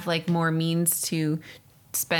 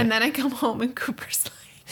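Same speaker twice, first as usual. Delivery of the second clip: breathy teasing whisper, pitched up; 6 semitones higher than usual. Real, not acted. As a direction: whisper it like a secret. Hushed teasing aside.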